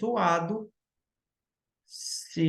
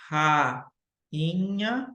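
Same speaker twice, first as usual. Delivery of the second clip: "rainha" very slowly split into ra, i, nha; syllable by syllable. Shown as a demonstration. Teacher contrasting it with ruínas, "rainha" slowly split by syllable. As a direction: stretch "rainha" out, pausing before the i. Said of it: "eu separo sa".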